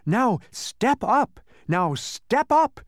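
high, loud, slow instructions; recommending mystery voice. Acted. Unseen voice tricking travelers into a pit, high slow urging. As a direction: draw out each order in a high voice. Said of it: "it recommended".

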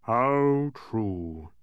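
drawn-out, mildly mournful king; understated royal gloom; unhappy. A narrator's reading. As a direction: agree ruefully. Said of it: "said the unhappy king".

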